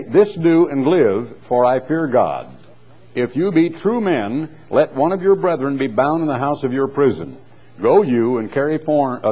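lifted public reading of scripture. Acted. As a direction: read the King James like from a pulpit. Reads scripture aloud.

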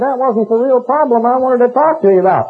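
put-on high, quavery old man protest; old man telling healer his real problem; old man complaining. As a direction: play the old fella's mild objection. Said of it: "but he said".

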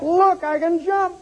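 high, thrilled cries of joy; ecstatic healed man. Acted. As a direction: crow with delight at walking again. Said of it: "He was excited".

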